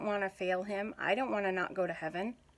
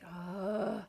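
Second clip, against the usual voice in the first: exasperated whispered ugh; 4 dB quieter than usual. Retold or imitated. Exasperated reaction to long silence.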